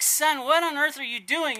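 a father recoiling at the pile of manure; shocked dad. Retold or imitated. Dad finds son in manure.

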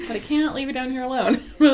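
normal talking voice

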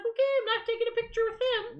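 high, scornful mock aside; mock huffy. To herself after Scott storms off, high and scornful. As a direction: brush him off in a high, put-out voice. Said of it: "I was like".

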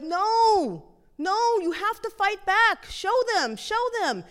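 high, forceful calling out; insistent acted big-sister command; urgent urging. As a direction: egg on a little brother in a fight. Sister urges brother to fight back.

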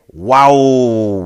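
long, loud drawn-out wow; hammed-up amazement. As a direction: stretch out a big showy exclamation. Sponsor read, exaggerated exclamation.

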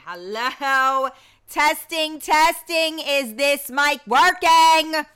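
calling out loud and high to test the mic; booming hello into a dead room; loud mic check. Real, not acted. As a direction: shout it out as if nobody can hear you. Checking whether the mic works.

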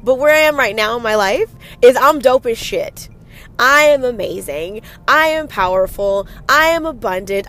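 loud, emphatic self-declaration; powerful affirmation. Shown as a demonstration. Host modelling an answer to the inner voice, powerful and bold. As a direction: declare it with power, hitting each statement. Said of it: "say it with power".

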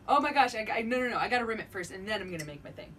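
ordinary talk, nothing marked

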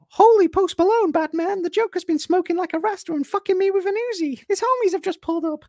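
very high, loud squeaky sidekick voice; Robin from sixties Batman. Retold or imitated. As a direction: chirp it like Robin in the old Batman show. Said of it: "nineteen sixties Batman".

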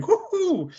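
high excited mock woohoo; a gleeful falsetto whoop at the photo. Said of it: "and he's like".

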